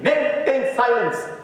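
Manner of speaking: high, loud shout for silence; a primary school teacher shouting at noisy kids. Retold or imitated. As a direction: bellow it like a fed-up teacher. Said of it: "teacher चिल्ला के कहती है".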